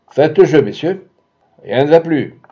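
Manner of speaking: lightly voiced casino croupier; croupier's call. A narrator's reading. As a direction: call the bets across the table. Croupier calling the bets.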